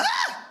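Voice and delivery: a very high short scream; panicked shriek. Retold or imitated. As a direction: yelp in sudden panic. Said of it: "I was like".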